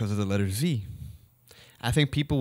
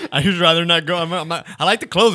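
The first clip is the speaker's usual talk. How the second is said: laughing higher and louder; 5 semitones higher, 8 dB louder than usual. Real, not acted. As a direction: laugh through the words. Laughing about avoiding the store.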